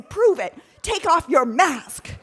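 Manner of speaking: loud, high challenge; shouting a dare at a hiker; angry shout. Retold or imitated. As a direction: dare her to prove it. Two women shouting at each other.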